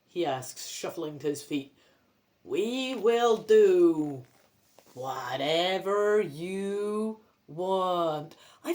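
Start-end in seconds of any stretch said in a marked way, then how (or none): 2.4-8.4 very slow, droning zombie; zombified, stretched-out monotone. Acted. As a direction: drag out every word like the hypnotised undead. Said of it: "I'll do a zombified f-, voice".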